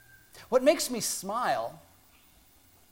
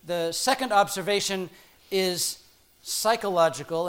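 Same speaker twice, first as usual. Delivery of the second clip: ordinary delivery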